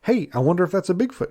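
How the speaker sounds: mock-excited hunter perking up at a crack in the woods; eager Bigfoot hunter. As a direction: jump at the sound hoping it's Bigfoot. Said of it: "you're gonna go".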